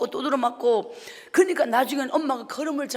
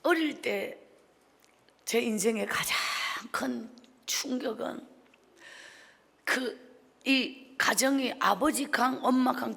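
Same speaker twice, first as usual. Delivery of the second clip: normal talking voice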